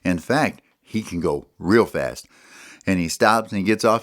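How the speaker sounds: plain reading voice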